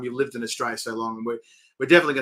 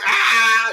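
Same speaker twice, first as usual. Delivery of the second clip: mock alarmed cry; 9 semitones higher, 9 dB louder than usual. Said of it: "And they're like".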